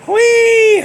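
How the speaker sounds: high, loud squeal; mock pig squeal. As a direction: squeal like a pig, imitating the film. Replays the squeal from film.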